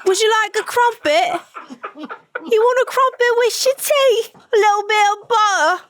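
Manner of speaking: very high, hammed-up British tea talk; British accent. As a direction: play an exaggerated British host offering tea. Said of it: "You gotta say".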